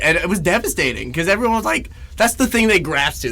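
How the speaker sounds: normal talking voice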